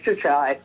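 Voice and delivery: Jachai misread with CH, "juh-CHAI"; mispronounced name. Retold or imitated. Host recalling their guess from mock drafts, mispronounced with CH. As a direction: say Jachai casually with CH as in chair. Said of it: "or something like that".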